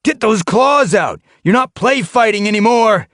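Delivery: harsh trainer shouting at his cats; berating shout. A narrator's reading. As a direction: tear into the lazy warriors. Leader shouting at fighters.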